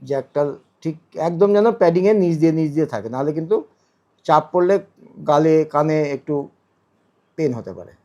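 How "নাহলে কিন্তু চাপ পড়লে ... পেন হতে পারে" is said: usual speaking voice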